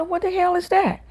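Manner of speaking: very high, baffled cry; baffled outburst. Retold or imitated. New student confused by classmates' noises, high baffled cry. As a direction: sound totally lost. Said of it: "I said".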